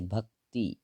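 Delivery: भक्ति in two separated parts, bhak-ti; spelling demonstration. Shown as a demonstration. Teacher showing the short vowel sign, measured spelling demonstration. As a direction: split भक्ति slowly, ending on a short i. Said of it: "भक्ति कैसे? ऐसे".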